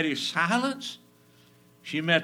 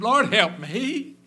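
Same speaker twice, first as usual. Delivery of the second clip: pleading woman; 10 semitones higher, 10 dB louder, slower than usual. Retold or imitated. Woman's plea voiced.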